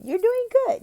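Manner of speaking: high, loud cheer of praise; encouraging praise. Shown as a demonstration. Tutor praises the child's reading with a high cheer. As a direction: lift the child's confidence.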